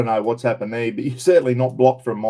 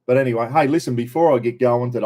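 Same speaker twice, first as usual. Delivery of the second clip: ordinary talk, nothing marked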